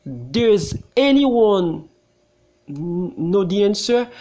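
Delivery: slow, word by word question; slow drilled question. Shown as a demonstration. Teacher drills the question for students, very slowly. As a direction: spell it out slowly for the class. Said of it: "M'a dit".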